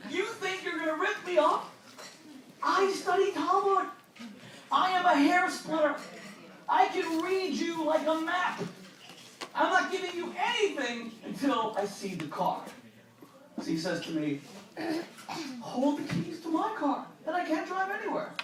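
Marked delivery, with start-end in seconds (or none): 0.1-12.6 pitched up, outraged and self-assured; puffed-up scholar sure he can't be fooled; indignant inner voice. Retold or imitated. Narrator's inner voice scoffing at Rafi, mock indignant. 15.6-18.3 lightly voiced, higher smooth talker; a con man soothing his mark; Rafi's voice. Rafi reassuring the narrator, pitched up and sly.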